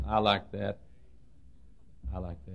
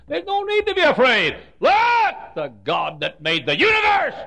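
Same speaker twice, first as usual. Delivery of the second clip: loud, slow, high preaching cry; preaching shout; 11 dB louder than usual. Acted. Preacher shouting to the congregation, full force. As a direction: thunder it out to the congregation.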